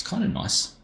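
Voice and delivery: "kinda nice" lifted up high in a light whisper; high whispered sing-song on "kinda nice"; high playful kinda nice. Real, not acted. Repeats the phrase differently.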